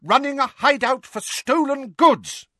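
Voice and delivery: high, loud angry shout; shouting mum. A narrator's reading. Mum telling off someone over stolen goods, shouting. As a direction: yell it, high-pitched and furious. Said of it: "hearing Mum shouting at someone else".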